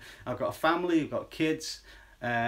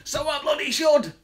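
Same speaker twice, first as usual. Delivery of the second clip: loud high spooky burst; jump-scare the listener; scary loud voice; 8 semitones higher, 7 dB louder than usual. Pretend. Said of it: "am I scaring you".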